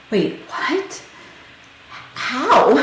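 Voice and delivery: retold stunned questions, lifted high; shocked. Wife questioning the nurse's news, shocked. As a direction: replay the disbelief, pitch jumping up. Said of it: "like, so shocked".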